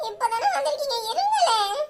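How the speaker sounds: high, teary plea; tearful pleading. Acted. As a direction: beg her to stay, about to cry. Begs mother to stay, crying.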